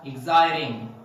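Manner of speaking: "exciting" said like "exciding"; American flapped T in "exciting"; T as a soft D. Shown as a demonstration. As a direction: tap the T in "exciting" into a D. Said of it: "change it into D sound".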